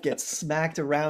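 ordinary talk, nothing marked

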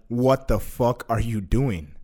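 low, slow inner voice; imitating a stern voice in the head. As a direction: say it slowly and low, weighing each word. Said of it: "another fucking voice in my head".

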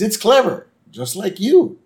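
high, flattering coo; lightly voiced souvenir pitch; hammed-up praise; sweet-talking salesman. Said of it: "He says".

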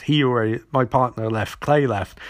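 usual speaking voice

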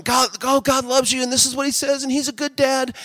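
high, lightly voiced eager preacher; mock preaching. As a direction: gush reassurances like an eager preacher. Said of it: "we're, like, preaching all the time".

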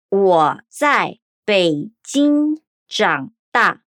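deliberate, syllable by syllable; 我在北京长大 said slowly as wǒ zài Běijīng zhǎng dà; slow Mandarin demo. Shown as a demonstration. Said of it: "Vamos repetir mais uma vez".

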